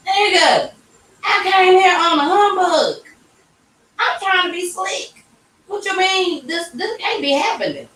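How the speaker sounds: high, panicked inner voice; high voice panicking to yourself; shocked disbelief. Retold or imitated. Retold inner panic at news.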